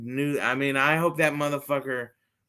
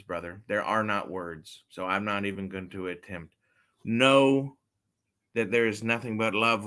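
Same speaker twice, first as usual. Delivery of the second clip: ordinary talk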